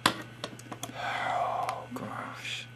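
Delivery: breathy, voiceless sigh of dismay; whispered dismay. Pretend. Despairing over a viewer's few videos, whispered dismay. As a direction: whisper it on a long exhale.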